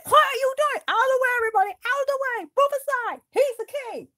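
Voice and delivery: high shouted clear-the-way calls; bossy herald. Acted. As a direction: order the crowd aside for the king. Clearing way for king.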